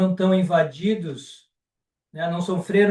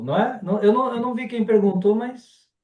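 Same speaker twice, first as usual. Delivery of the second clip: ordinary talk, nothing marked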